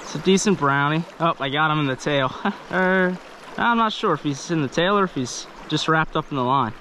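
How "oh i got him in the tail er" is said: normal talking voice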